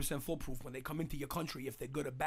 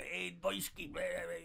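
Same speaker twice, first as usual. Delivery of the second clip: mock drunken Russian accent; 3 semitones higher, slower than usual. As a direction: babble like a vodka-drunk Russian with a thick accent. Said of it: "You nailed that accent".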